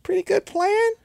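high, grudging admiration; high-pitched, impressed aside; impressed reluctant admiration. Retold or imitated. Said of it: "I was like".

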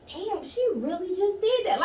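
high stunned mutter; stunned disbelief. Retold or imitated. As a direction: say it like you can't believe she did that. Retold high reaction.

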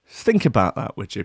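ordinary delivery

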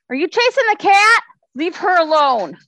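loud high scolding call; yelling at the dog. Real, not acted. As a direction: shout at the pet to stop. Yelling at dog chasing cat.

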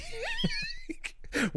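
shrieking laughter near tears; cry-laugh up high. Real, not acted. Big laugh at joke.

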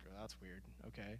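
low, quiet, uneasy aside; mock a polite, hushed reply to a friend; awkward reaction. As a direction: say it low and under your breath. Acting a listener's uneasy reaction.

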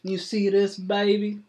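high, loud country voice; an old farmer happy to get his hat back; hillbilly farmer, delighted. Acted. GM voices Cletus Ray.

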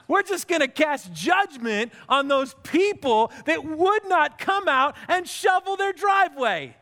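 ordinary delivery

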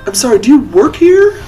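high, prim, haughty lady voice; haughty noblewoman. Acted. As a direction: look down your nose at him. Noblewoman questions the gnome.

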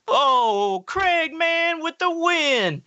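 very high, drawn-out celebration; mock cheering. Storyteller as Derek cheering the found rock, mock celebration. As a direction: hail the hero in a stretched, high voice.

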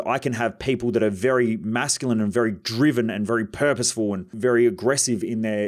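ordinary talk, nothing marked

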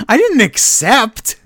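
high, loud, drawn-out imitation; mock defensive protest. Recapper as wrestler answering his panicking friend, mock protest. Acting out Cody's defensive reply.

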